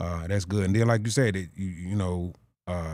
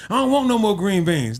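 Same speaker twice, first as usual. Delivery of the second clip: raised, higher complaint over dinner; mock irritated outburst; 9 semitones higher, 5 dB louder than usual. Tired worker at the family dinner table, mock irritated outburst. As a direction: act out a loud gripe about food.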